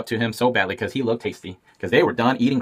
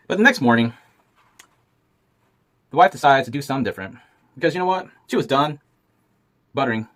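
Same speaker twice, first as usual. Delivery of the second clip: ordinary talk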